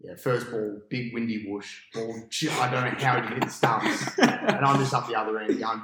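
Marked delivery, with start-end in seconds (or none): none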